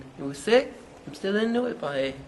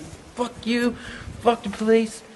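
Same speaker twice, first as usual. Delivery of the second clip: defiant yell; 8 semitones higher, slower than usual. Retold or imitated. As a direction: shout it at the cop. Yelling at cop in story.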